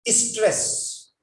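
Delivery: "stress" with an extra i in front, is-tress; "stress" said as istress; mispronounced stress. Shown as a demonstration. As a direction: put a short "i" before the s of "stress". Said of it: "There are some people who say".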